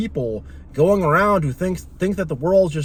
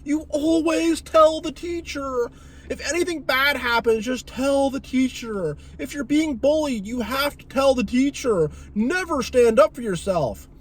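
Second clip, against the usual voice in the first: mocking the rules; 7 semitones higher than usual. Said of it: "we have this thing as a kid where it's like".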